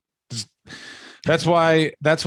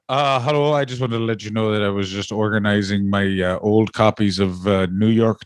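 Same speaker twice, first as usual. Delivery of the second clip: lower, steady Irish impression; lower Sheamus voice; 5 semitones lower than usual. Lower Irish voice doing Sheamus for his cohost. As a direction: drop the voice low as Sheamus.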